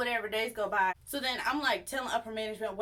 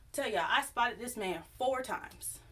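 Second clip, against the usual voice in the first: normal talking voice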